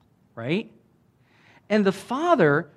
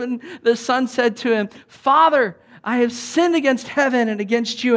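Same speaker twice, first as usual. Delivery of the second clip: preaching Scripture reading; 9 semitones higher, 6 dB louder than usual. Acted. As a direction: proclaim the verses with full force.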